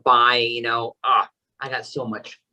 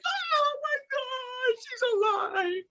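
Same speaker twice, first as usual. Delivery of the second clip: very high, drawn-out squeal, held back in volume; retold scream; 8 dB quieter, slower than usual. Reliving when he walked in, thin high mock scream. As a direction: squeal it slowly, far up high, without full loudness. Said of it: "I was screaming".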